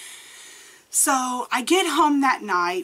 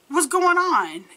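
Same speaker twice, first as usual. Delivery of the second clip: higher-pitched retold alarm; 7 semitones higher, slower than usual. Gerald finding her frozen upstairs, retold alarm. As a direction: imitate someone suddenly on guard. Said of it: "protection mode".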